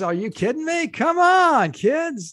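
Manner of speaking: high, loud, swooping scoff; hammed-up dismissive local, dragging out the last words; mock disbelief. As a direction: play an incredulous anti-vaxxer for laughs. Mocking local anti-vaccine Texans.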